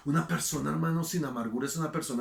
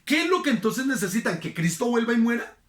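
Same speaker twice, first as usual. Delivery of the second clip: indignant retort; 8 semitones higher, 5 dB louder than usual. Retold or imitated.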